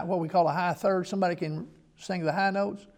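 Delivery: ordinary talk, nothing marked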